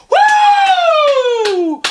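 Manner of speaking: loud, high-pitched cheering whoop; whooping fan. Retold or imitated. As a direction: whoop like an overexcited hockey fan. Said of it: "He's going".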